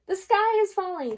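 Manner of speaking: high, loud panicked cry; crying out in alarm. Acted. Hen crying to her friends in high loud panic. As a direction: shriek the news that the sky falls. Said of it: "cried Henny Penny".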